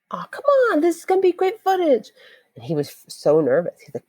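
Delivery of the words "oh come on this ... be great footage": much higher, whining protest; pleading to keep the camera out; whiny pleading. Retold or imitated. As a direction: beg like a kid told no. Narrator pleads to keep filming.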